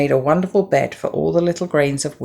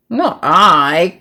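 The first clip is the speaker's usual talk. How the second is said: quacked; 3 semitones higher, 6 dB louder than usual. Acted. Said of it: "quacked the duck".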